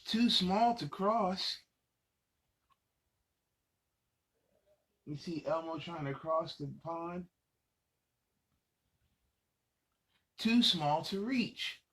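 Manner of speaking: put-on lively read-aloud for little kids; reading to children. Adult reading a picture book to young children, lively storytelling. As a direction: read it out bright and animated for the kids.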